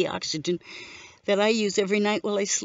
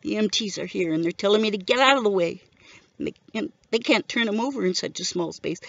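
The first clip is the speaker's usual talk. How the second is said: plain reading voice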